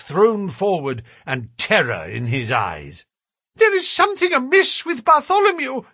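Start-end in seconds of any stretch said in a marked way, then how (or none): 3.5-5.8 high, loud, fast panicked cry; frightened cry. A narrator's reading. Thaddeus bursting from the house, high frightened cry. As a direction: blurt it out in terror. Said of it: "he cried".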